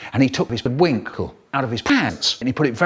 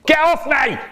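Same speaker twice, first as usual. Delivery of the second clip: roaring; 12 semitones higher than usual. Acted. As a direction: bellow it from the floor. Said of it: "Richard is on the floor roaring".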